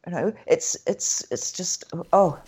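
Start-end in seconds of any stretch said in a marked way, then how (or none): none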